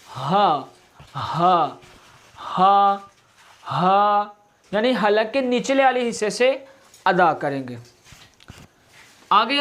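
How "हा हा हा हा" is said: हा with a strong throat h, not a plain आ; breathy throat ha. Shown as a demonstration. Teacher drilling हा so it is not read as आ. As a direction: push हा out from the low throat. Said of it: "इसे आ नहीं पढ़ेंगे".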